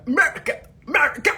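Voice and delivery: high, loud, sing-song "America"; hammed-up American cry; mock American. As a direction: shout "America" like a cheerleader. Exaggerated mock pronunciation.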